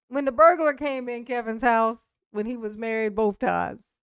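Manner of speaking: ordinary talk, nothing marked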